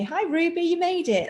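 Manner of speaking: bright high sing-song hello to a pet; high pet greeting. Real, not acted. Teacher breaks off to greet her cat Ruby, high cooing hello.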